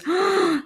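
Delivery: a sharp, high intake of breath in delight; real delighted gasp. Real, not acted. Hearing about a glowing clicky keyboard, a high delighted gasp. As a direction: gasp as if you've just seen the perfect gadget.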